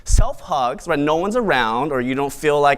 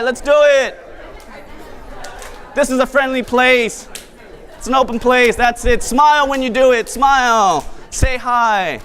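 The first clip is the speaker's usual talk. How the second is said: bright event host; 9 semitones higher than usual. Acted. Rallies the crowd to mingle.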